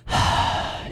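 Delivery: breathy tired sigh; soft sigh. A narrator's reading. Klaus and Violet sigh after the judge leaves, soft weary sigh. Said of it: "looked at each other and sighed".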